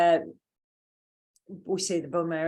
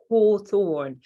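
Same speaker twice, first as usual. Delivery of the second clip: hawthorn said again in British accent, louder, falling at the end; 6 dB louder than usual. Shown as a demonstration. Teacher repeating hawthorn for the class, in British accent. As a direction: repeat hawthorn the English way, firmly. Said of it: "with my lovely British accent".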